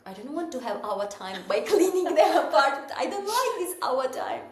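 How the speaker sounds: protesting. Retold or imitated. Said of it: "And I was like".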